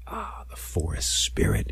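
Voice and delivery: awed hushed whisper. Acted. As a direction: whisper it to yourself. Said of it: "he said to himself".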